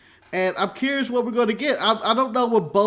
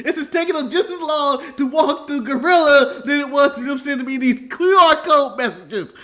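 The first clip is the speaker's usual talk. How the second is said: ordinary delivery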